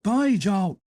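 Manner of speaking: short burst toward the door; sudden call. Acted. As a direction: call out across the room. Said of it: "called out suddenly".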